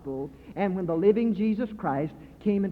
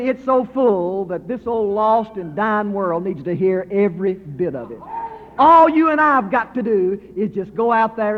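preaching at full force; 6 dB louder than usual. Acted. Preacher urging his friends to tell the gospel, a loud ringing proclamation. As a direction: proclaim it to the whole hall.

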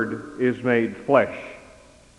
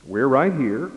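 mild parent reassurance; 2 semitones higher than usual. Acted. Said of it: "the typical parental response".

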